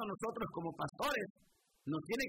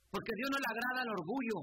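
usual speaking voice

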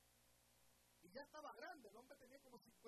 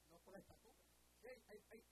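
ordinary talk, nothing marked

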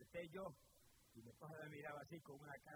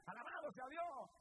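raised, ringing sermon voice; fervent preaching; 6 semitones higher, 3 dB louder than usual. Acted. Preacher urging the listeners to accept Christ, fervent preaching. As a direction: preach it out to the whole church.

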